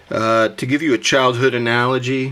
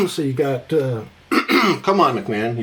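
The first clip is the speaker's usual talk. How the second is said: losing his voice. Real, not acted. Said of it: "You're losing your voice, dude".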